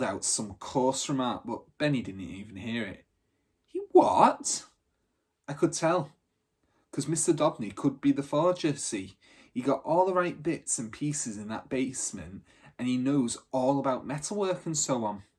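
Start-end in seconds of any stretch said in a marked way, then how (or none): 3.6-4.7 high loud shocked outburst. Acted. Benny shocked by news. 5.5-15.2 lightly voiced girl, a little higher and louder; lightly voiced tough girl, earnest; Bridie explaining.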